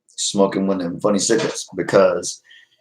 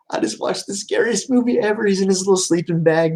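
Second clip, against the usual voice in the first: lightly voiced English guy, higher; retelling the English YouTuber's night in the car, lightly mimicked; 10 semitones higher than usual. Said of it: "he said".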